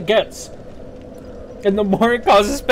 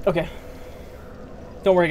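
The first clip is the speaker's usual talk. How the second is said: ordinary delivery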